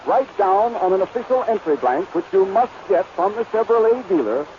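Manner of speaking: ordinary delivery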